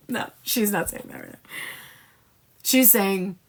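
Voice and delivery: normal talking voice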